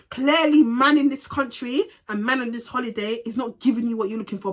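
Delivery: ordinary delivery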